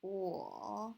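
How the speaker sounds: "wo" dipping down and back up; drawn-out falling-rising "wo"; third tone. Shown as a demonstration. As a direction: take "wo" through a valley. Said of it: "one, two, three".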